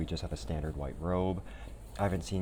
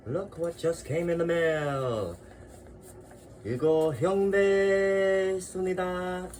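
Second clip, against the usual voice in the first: high, loud, thrilled announcement; excited high voice; 10 semitones higher, 4 dB louder than usual. Real, not acted. Showing the new package to viewers, high and excited.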